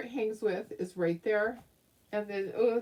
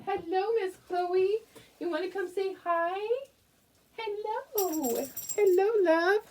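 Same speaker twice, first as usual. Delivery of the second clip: talking to the dog; 9 semitones higher than usual. Real, not acted. Greeting her dog Chloe in high doting baby talk.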